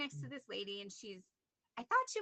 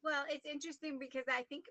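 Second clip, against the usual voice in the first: normal talking voice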